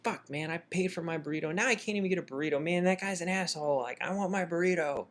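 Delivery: high-pitched, mock tearful complaint; upset whining. Punched customer lamenting lost food, high upset whine. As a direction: whine like a wronged kid, hammed up. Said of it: "I started to get really upset".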